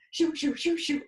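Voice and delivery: quick high whoosh noises, mimicking spirits rushing by; playful whoosh effect; whooshing sound. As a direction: swish them past like passing ghosts. Spirits rushing past the door.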